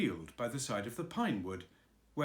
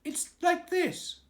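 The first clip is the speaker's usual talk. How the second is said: much higher, slow squeaky piglet voice; 7 dB louder, slower than usual. Acted. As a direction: squeak it in to rescue Pooh. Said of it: "said Piglet quickly".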